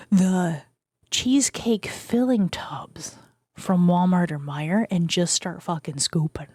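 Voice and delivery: low, intimate close-mic murmur and whisper; ASMR whisper. Pretend. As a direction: whisper a mock-seductive snack pitch right into the mic. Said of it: "I could talk like this forever".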